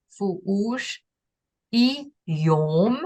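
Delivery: "Fu ush" then "i yom" set apart, each part clear; Aui phrase. Shown as a demonstration. Teacher setting a small Aui puzzle for the listener. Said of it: "Let's see if you can figure that out".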